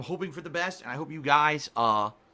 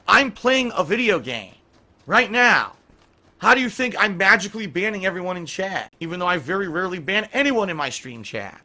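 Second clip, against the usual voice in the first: loud exasperated rant, higher pitch; angry; 4 semitones higher, 7 dB louder than usual. Real, not acted. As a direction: snap back at the accusation. Angry rebuttal of chat accusation.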